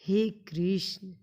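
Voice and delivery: हे कृष्ण called out slow and loud, vowel stretched; हे कृष्ण as a long vocative call; drawn-out call. Shown as a demonstration. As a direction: call हे कृष्ण across a distance, holding the vowel. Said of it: "जैसे".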